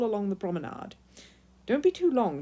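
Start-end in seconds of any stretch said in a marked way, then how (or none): 1.7-2.4 a firm, raised warning; mother warning a bear not to be long, firmly. A narrator's reading. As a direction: call after him to be careful. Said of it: "she warned".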